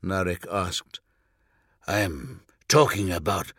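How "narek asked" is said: usual speaking voice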